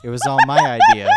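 high, loud, laughing mock confession; laughing boast. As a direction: crow it out through laughter. Defiant confession in role, laughing.

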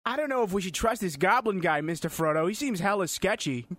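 cartoonish Boston sidekick voice; Boston guy. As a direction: warn your master in mock Boston. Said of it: "your Boston guy is always Peter Griffin".

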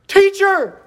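high acted shout for help; panicked yelling. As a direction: cry out in mock panic, pitch way up. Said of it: "they're just yelling out".

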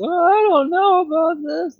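squeaky, very high dithering guard; Donkey Brain. Acted. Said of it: "Donkey Brain goes".

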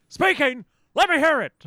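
high, loud barking captain with a laugh; Klingon commander. Retold or imitated. Voicing a Klingon commander to his officer, high and loud. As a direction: shout it like a suspicious villain. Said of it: "My lord".

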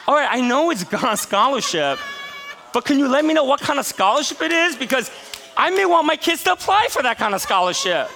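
high, loud, laughing push for details; half-joking plea, laughing through it; laughing insistence. Retold or imitated. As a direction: press him while cracking up. Pressing pastor, laughing.

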